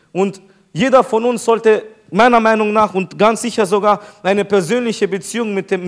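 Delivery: ordinary talk, nothing marked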